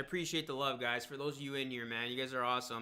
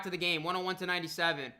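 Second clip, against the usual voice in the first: live play-by-play; 2 semitones higher, 5 dB louder than usual. Acted. Live game commentary.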